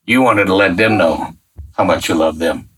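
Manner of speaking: awed prayer. Retold or imitated. Realizing why God moved him there, hushed and low. Prayer continues after listener.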